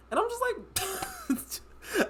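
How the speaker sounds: high, slow disbelieving whine; exasperated whine. Retold or imitated. Exasperated stretched cry.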